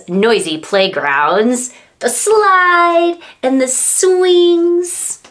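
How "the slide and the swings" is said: excited read. Acted. Excited reading about playgrounds.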